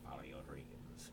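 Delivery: slow, soft whispered wisdom; hushed sage advice, slow; whispered fortune-cookie wisdom; mock wise whisper. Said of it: "people listen to Morgan Freeman".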